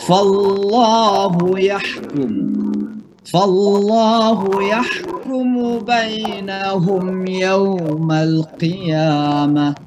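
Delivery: slow, high chanted recitation with ghunnah; Quran recitation. Shown as a demonstration. Teacher chants the verse for students, slow recitation.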